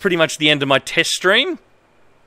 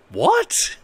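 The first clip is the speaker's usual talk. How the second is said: high, rising disbelieving what; baffled what. Real, not acted. Streamer reacting to a nonsense line, high baffled rise. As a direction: shoot up in pitch in total confusion.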